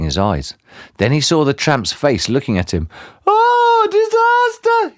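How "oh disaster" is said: loud, very high panicked scream. A narrator's reading. Mister Jelly seeing the tramp's face, screaming in terror. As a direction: scream it in squeaky horror, drawn out. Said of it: "he screamed".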